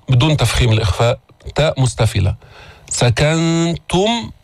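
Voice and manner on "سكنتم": slow "سكنتم" with a hummed n and light, rounded "tum". Shown as a demonstration. Teacher drills the damma, slow chanted "سكنتم" with light ت. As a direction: round the ت of "سكنتم" without making it heavy. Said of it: "ضمينا التاء المضمومة بدون تفخيم".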